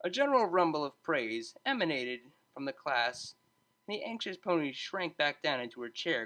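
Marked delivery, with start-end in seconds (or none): none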